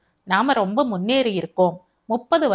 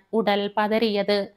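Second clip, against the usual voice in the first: plain reading voice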